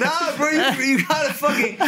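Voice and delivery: high, slow words through laughter; laughing. Real, not acted. Admitting to friends the story sounds dumb, laughing. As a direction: laugh while protesting.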